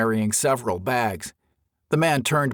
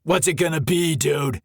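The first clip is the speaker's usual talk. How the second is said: stern; 5 semitones higher than usual. A narrator's reading. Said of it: "the man said sternly".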